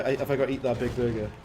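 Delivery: ordinary talk, nothing marked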